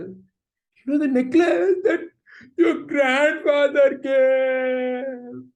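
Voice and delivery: loud, high, long-drawn wail; acted crying. Mother recalls grandfather's gift, acted wailing. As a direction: stretch the words into a hammed-up sob. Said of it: "So the mother is crying".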